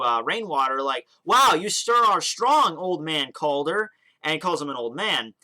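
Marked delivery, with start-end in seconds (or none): 1.2-3.9 slow, high, loud call-out; mock shout. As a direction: call over to someone, drawing the words out. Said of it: "Smith eventually shouts over to".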